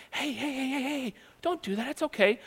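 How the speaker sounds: high, quiet, gentle hushing; soft hushed plea. Shown as a demonstration. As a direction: coax him softly, almost whispering. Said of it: "did not whisper".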